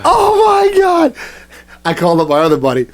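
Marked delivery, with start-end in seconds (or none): 0.1-1.2 very high, drawn-out squeal; re-enacted high-pitched hysterical squeal; retold hysterics. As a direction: wail it high, as if helpless with laughter. Retold helpless hysterics, repeated.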